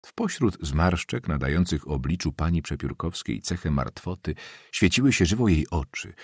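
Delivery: ordinary delivery, nothing marked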